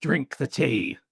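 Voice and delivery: mock Scottish mum ordering her child; playful Scottish accent, as a mum giving orders; imagined Scottish mum. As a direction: put on a Scottish parent's accent. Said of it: "your mum sounds like".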